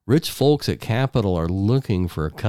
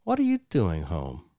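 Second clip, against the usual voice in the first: ordinary delivery, nothing marked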